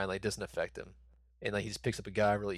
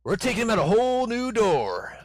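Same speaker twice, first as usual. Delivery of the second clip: high, loud hammed-up movie line; movie quote voice; 12 semitones higher, 8 dB louder than usual. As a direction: play the line like a hero's bold exit.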